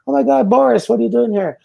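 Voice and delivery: high, excited mock surprise; surprised greeting. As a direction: imitate old friends spotting him unexpectedly. Old friends surprised to see him.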